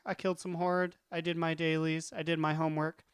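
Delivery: ordinary talk, nothing marked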